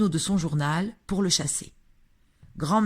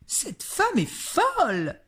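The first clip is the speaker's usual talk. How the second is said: high, slow indignant outcry; cry out; 12 semitones higher, slower than usual. A narrator's reading. Mrs Jenkins crying out about grandmother, high and outraged. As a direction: shriek it in shocked disbelief. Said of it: "s'écria madame Jenkins".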